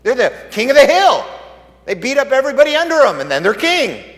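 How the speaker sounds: high, loud mocking of worldly kings; loud preaching. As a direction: ring it out loud and high, scornful of proud kings.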